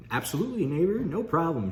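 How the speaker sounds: high-pitched mock-agreeable neighbor; imagined helpful neighbor. Employee imagining his neighbor's reply to jumpstarting, high mock-agreeable voice. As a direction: imitate an obliging neighbor, pitched well up. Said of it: "you know, the expected".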